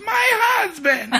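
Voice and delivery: very high imitation wail with a laugh; squealed play-acted indignation; mock indignant wife. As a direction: imitate a shrieking wife, laughing as you finish. Retold wife answers indignantly.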